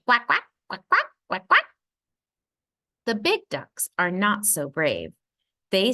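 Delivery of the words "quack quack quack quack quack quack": higher, louder little-duck quacks; small duck quack. A narrator's reading. Reading to a child, quacking like the small brave ducks. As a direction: quack like a tiny eager duckling. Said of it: "They say".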